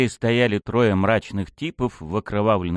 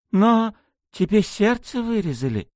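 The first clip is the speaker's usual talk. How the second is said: high, agitated masked man; bewildered; 10 semitones higher than usual. A narrator's reading. Tiger-masked man questioning his heartless victim, bewildered and high.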